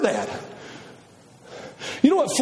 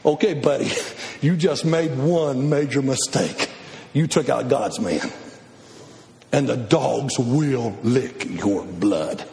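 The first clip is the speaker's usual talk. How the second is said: low ominous warning; 12 semitones lower, slower than usual. Pretend. Prophet confronting king.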